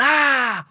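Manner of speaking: faked pain yelp. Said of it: "I was diving on the floor and going".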